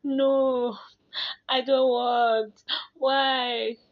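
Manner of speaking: put-on loud, high, drawn-out childish wail; mock crying. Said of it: "I started crying".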